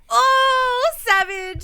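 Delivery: high, loud "Oh, savage" with a stretched oh; impressed oh savage. Retold or imitated. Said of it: "And I was like".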